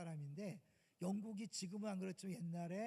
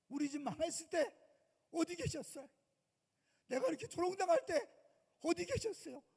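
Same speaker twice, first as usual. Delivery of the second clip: resentful complaint to God; 6 dB louder than usual. Retold or imitated. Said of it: "이렇게 원망하고 싶잖아".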